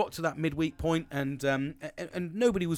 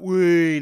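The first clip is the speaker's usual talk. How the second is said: playful whee; 4 semitones higher, 13 dB louder than usual. Pretend. Playful aside about fruity weekend.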